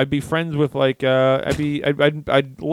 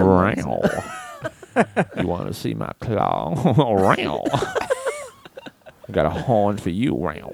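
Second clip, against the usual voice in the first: playful monster voice; 2 semitones lower, much slower than usual. Pretend. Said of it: "I'm a monster".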